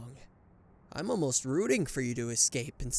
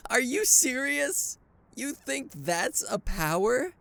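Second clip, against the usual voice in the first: high, slow incredulous scoff; scoffing disbelief; 9 semitones higher, 3 dB louder, slower than usual. Acted. Villain scoffing at the hero's powers, incredulous. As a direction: sneer in disbelief.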